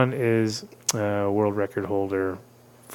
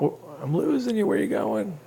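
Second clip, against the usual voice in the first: raised high call down a bad line; shouting into a phone; 7 semitones higher, 3 dB quieter than usual. Real, not acted. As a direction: call out to a fading caller. Losing caller on phone line.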